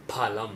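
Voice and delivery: "phalam" with an aspirated p, not an f. Shown as a demonstration. Teacher demos the Sanskrit aspirated p in phalam. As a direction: puff of air on the p, no f. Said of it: "not phalam but phalam".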